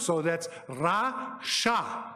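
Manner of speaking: Rasha slow, both vowels open ah; Hebrew pronunciation Rasha. Shown as a demonstration. Teacher repeating rasha so students can copy it. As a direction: say Rasha slowly and clearly. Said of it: "that's how you'd pronounce it".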